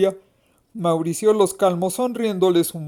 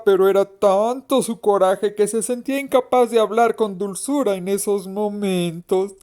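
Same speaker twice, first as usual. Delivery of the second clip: ordinary delivery, nothing marked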